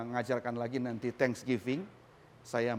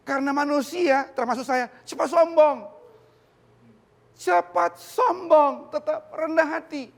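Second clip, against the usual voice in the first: loud, high, emphatic preaching; full-force preaching; 12 semitones higher, 8 dB louder than usual. Acted. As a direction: preach it out to the congregation with fire.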